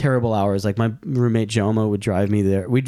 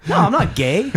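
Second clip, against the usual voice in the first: high, louder insistent voice; high, louder mock denial to the shop clerk; mock defensive self; 9 semitones higher, 3 dB louder than usual. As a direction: protest too much, hammed up. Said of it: "I was like".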